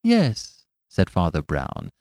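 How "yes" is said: high, light and absent-minded; vague yes. Acted. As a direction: answer vaguely, far away. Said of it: "with the same strange vagueness of manner".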